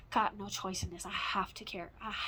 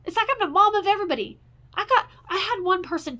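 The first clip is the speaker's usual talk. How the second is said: fast, high and loud blurted complaint; real fed-up shout; angry outburst; 9 semitones higher, 8 dB louder, faster than usual. Real, not acted. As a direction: spit it out in a rush of frustration. Ranting about being everyone's mom.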